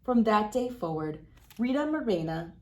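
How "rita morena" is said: usual speaking voice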